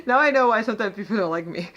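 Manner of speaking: laughing through the words; real laugh breaking in, then quick; giggling about her own sarcasm; laughing at herself. Real, not acted. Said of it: "I'm very sarcastic".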